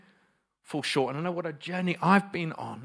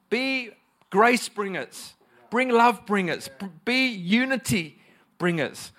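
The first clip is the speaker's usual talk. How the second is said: loud, raised preaching voice; 7 semitones higher, 4 dB louder than usual. Real, not acted. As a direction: drive each exhortation home to the congregation. Preaches at full force.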